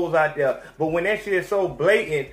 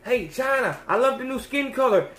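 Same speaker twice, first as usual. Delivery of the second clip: higher, sing-song mock flattery; butter her up, pitched up; fawning paparazzo; 6 semitones higher than usual. Paparazzi cameraman fawning over Kim on the street, higher mock flattery.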